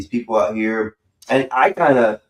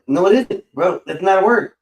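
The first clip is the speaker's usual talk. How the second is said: higher, insistent retort; retelling an argument with Vanta, higher and insistent; mock insistent; 9 semitones higher, 3 dB louder than usual. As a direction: insist like you're winning the argument.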